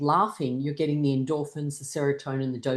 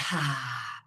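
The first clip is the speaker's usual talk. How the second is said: sighing "ha" falling as the breath leaves; demonstrated breath release; long exhaled sigh; 2 semitones lower, 4 dB quieter than usual. Shown as a demonstration. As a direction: breathe all the way out audibly. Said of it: "and breathe out".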